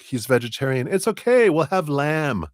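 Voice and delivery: high, fussing Greek aunt; Greek aunt accent. Retold or imitated. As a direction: play the doting Greek aunt, jumping up high. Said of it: "that's that kind of accent".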